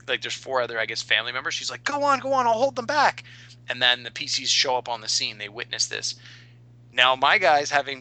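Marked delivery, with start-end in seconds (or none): 1.8-3.2 high, heroic cry of a mother holding the line; a high-pitched stand-and-fight call to her children; brave mother. Acted. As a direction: urge the family to flee while you stand fast. Fighter mother shields her family.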